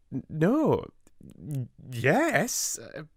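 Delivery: high, stumbling "N-, no, y-, yes"; flustered stammer. Acted. Amelia caught out by her sister, flustered stammer. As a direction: trip over yes and no. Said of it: "she said".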